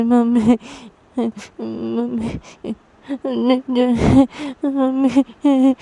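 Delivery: loud, drawn-out wailing; crying child. Acted. Piu's daughter clinging to Rui, crying loudly. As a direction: wail for mummy like a child in tears. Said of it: "रोते हुए बोलती है".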